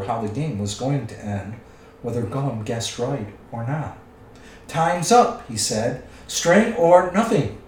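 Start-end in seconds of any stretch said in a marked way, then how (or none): 4.7-5.4 raised, louder announcement; calling time. A narrator's reading. Bilbo ending the guessing game, calling time. 6.3-7.6 high, loud, slow desperate cry; shrieked. A narrator's reading. Gollum desperate with his last guess, shrieking. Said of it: "shrieked Gollum".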